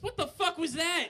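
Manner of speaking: high, loud lightly voiced kid; a hint of a startled child; nine-year-old kid. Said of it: "kid looks up to me and says".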